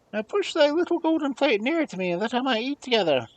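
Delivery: high, fast little frog voice; demanding frog asking for the golden plate. Acted. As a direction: squeak it out like a pushy frog. Frog speaks at the table.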